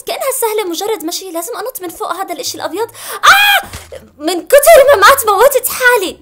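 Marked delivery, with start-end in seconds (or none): 3.2-3.7 sudden high shriek of shock; startled scream. Real, not acted. Startled by sudden death in game. 4.2-6.2 loud, high exasperated yell; frustrated shout. Real, not acted. Frustrated at repeated deaths.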